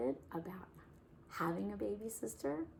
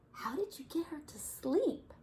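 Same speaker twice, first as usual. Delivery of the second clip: Nora voice; 10 semitones higher than usual. Acted. Nora asking in story.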